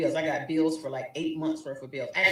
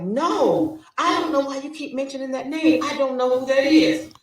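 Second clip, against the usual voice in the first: higher, louder indignant husband; husband's denial; 9 semitones higher, 8 dB louder than usual. Retold or imitated. Husband denying knowing the woman, loud and indignant. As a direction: protest too much. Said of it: "He was like".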